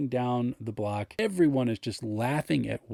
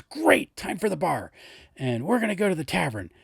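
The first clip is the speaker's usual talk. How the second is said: eager cheer; 6 semitones higher than usual. Retold or imitated. Player cheers going to bar.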